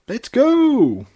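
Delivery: very high, loud burst; high loud squeal of glee; excited cheer. Acted. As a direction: launch it like a party popper. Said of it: "Pinkie said excitedly".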